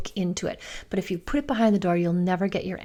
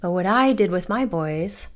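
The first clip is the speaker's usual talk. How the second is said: ordinary delivery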